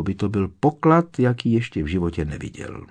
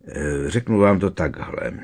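usual speaking voice

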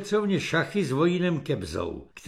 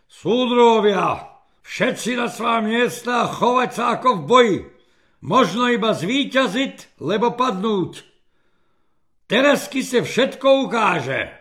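raised, higher Slovak-speaking commander's voice; rallying major; 5 semitones higher, 4 dB louder than usual. Acted. Major sends his comrades to their posts, rallying call.

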